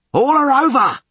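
very high, loud command; roared order. A narrator's reading. Skipper giving helm order, high loud roar. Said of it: "roared Podlorak".

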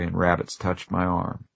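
plain reading voice

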